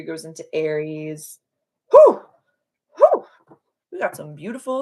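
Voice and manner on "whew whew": high, loud burst of excitement; excited whew. Real, not acted. Astrologer reacts to Mercury entering Aries with a thrilled whoop.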